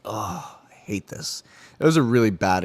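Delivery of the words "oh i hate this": inner groan. Retold or imitated. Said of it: "and I'm just like".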